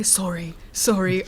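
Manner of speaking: softly repeated "sorry"; small, quiet repeated sorry; mouthed apology. Acted. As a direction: practise it under your breath.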